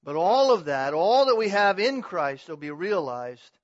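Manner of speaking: loud pulpit emphasis, leaning on key words; emphatic preaching. Real, not acted. Preacher assuring the church all will be realized, emphatic preaching. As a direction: proclaim it firmly and loudly.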